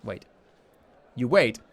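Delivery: ordinary talk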